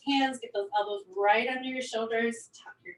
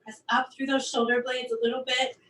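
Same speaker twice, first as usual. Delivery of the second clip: ordinary talk, nothing marked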